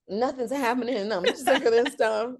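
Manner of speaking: frustrated. Retold or imitated. Praying to God while walking, fed-up complaint. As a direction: vent it up high, fed up. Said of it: "I'm like".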